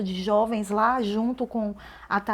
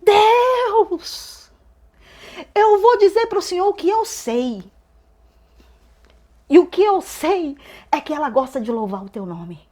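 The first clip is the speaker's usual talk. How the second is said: loud, high fervent intercession; crying out in prayer; 7 semitones higher, 7 dB louder than usual. Retold or imitated. Church woman interceding for the young woman, loud impassioned cry. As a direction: call out to God, each phrase starting high and falling. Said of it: "ela gritava, ela dizia".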